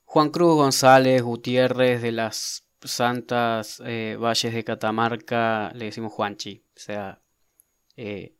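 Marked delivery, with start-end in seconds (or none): 0.0-5.7 slow mock full name. Shown as a demonstration. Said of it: "si vemos a un".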